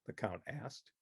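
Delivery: usual speaking voice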